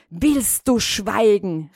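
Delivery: menacing demand for silence; angry cry. A narrator's reading. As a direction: cut the mouse off with a forceful order. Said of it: "rief die Katze".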